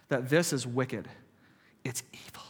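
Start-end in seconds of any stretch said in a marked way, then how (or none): 1.9-2.4 a sudden hushed whisper. Real, not acted. Preacher to congregation about the flesh, whispered. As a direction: drop to a bare whisper to stress it. Preacher naming sin as wicked.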